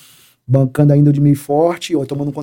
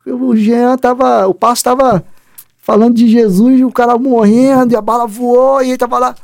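higher, teasing gossip voice; mocking colleagues; 8 semitones higher than usual. Fellow officers mocking him, in a raised teasing voice. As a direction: mimic the guys ribbing him the next day. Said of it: "Vão falar".

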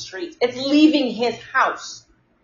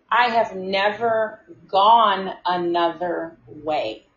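each word drawn out deliberately; slow emphatic repeat; much slower than usual. Retold or imitated. Worker insisting to a client about the route, slow and emphatic. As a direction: spell it out slowly, with pauses between words.

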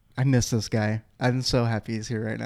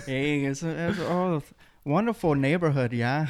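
higher, bouncy mock Canadian accent; 4 semitones higher than usual. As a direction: sing out the small talk like a friendly Canadian. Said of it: "the Canadian accent, eh?".

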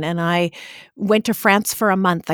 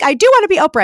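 high, loud, eager retort; 9 semitones higher, 7 dB louder, much faster than usual. Retold or imitated. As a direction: fire back that yes, you really do. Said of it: "I'm like".

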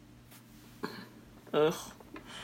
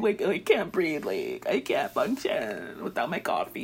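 mock helpless whining; 4 semitones lower than usual. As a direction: imitate someone barely surviving without luxuries. Mocks a helpless rich person.

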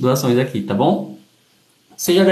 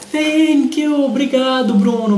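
agradecimento animado; 8 semitones higher, slower than usual. Real, not acted. Agradece presente na live.

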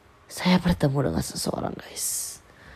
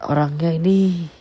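ordinary talk, nothing marked

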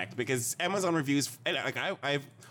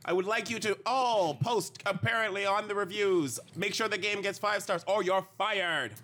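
bossy boss memo; 8 semitones higher than usual. Retold or imitated. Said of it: "the memo that goes around your office that says".

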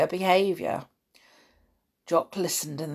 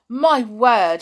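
gasp, then a higher, louder exclamation; awed excitement; 5 semitones higher, 9 dB louder than usual. A narrator's reading. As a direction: burst out amazed. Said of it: "an exciting part".

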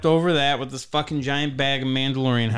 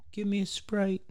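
put-on high, whispered kid's request; tiny whispered imitation of a kid; imitated faint request; 8 semitones higher, 6 dB quieter than usual. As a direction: whisper it high, barely audible. Child's faint request from behind.

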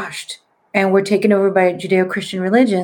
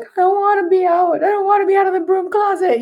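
high, wailing retold protest; hammed-up tearful wail; mock crying; 10 semitones higher than usual. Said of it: "I started crying 'cause I was like".

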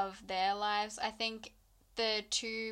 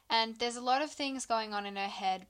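ordinary delivery

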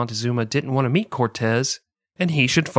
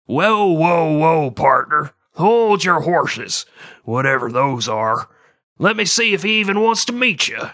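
hammed-up cowboy drawl, louder and higher; 4 semitones higher, 3 dB louder than usual. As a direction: play a Wild West cowboy reining someone in.

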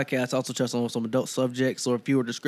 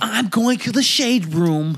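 slow, louder gossipy put-on voice; mock gossip voice; 5 semitones higher, 5 dB louder, slower than usual. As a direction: ham up a messy gossip. Mocking gossip voice.

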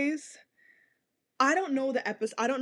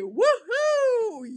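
high, loud mocking woohoo; 9 semitones higher, 5 dB louder than usual. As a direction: whoop it up in mockery. Mock cheer in character.

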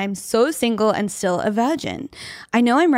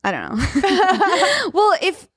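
laughing; 8 semitones higher, much slower than usual. Real, not acted. Laughs at her own joke.

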